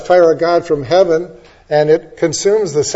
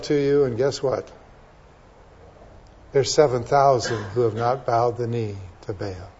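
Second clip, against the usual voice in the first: softer, lower voice of God calming Elijah; gentle God; 5 semitones lower, 8 dB quieter than usual. Retold or imitated. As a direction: talk him down gently.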